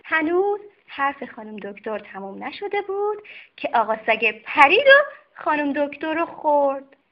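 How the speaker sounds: plain reading voice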